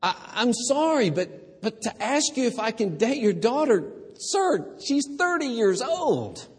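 put-on high, pleading young man; nervous suitor. Suitor answering the angry father, high and nervous. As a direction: protest politely but flustered.

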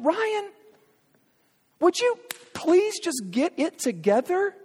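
the fed-up dad yelling through the door; exasperated parent. Retold or imitated. Imagined God scolding him.

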